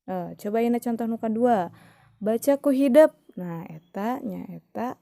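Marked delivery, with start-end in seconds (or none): 2.2-3.1 "Baca ku hidep." ordered high and loud, stress on "hidep"; command tone. Shown as a demonstration. Teacher demonstrating a command sentence, high and loud. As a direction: tell them firmly to read. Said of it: "dina intonasina oge pasti beda".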